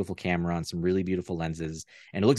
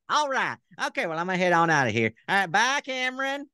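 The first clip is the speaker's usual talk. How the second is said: much higher folksy puppet voice greeting the host; Southern puppet character; 8 semitones higher than usual. Pretend. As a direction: play the friendly talk-show puppet. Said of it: "I'll try him on".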